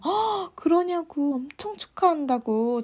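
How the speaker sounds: high gasp, then easing congratulations; retold amazed congratulations. Congratulating roommate on brother's acceptance, re-enacted excitement. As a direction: gasp and gush, re-enacting delight.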